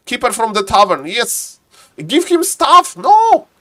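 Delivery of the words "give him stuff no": high, loud urging; urgent exclamation. Real, not acted. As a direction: cry out advice to the player in a high voice. Urging the player during the match.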